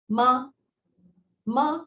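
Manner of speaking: ma held level and high; first tone ma. Shown as a demonstration. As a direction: sing out ma on a steady high pitch. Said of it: "this is called the flat tone".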